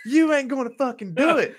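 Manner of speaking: high, loud mock holler; hollered dare. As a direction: call it out like a rowdy challenge. [name] repeats his shouted dare.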